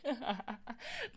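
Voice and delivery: soft, real giggle. Real, not acted. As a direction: giggle lightly at the teasing. Laughs at space drunk remark.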